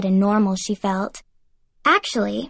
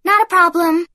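much higher, louder, brisk; breezy confidence; 8 semitones higher, 6 dB louder than usual. A narrator's reading. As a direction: toss it off breezily. Aphrodite brisk.

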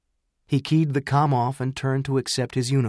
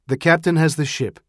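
formal announcement; 5 semitones higher, 6 dB louder than usual. A narrator's reading. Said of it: "he announced formally".

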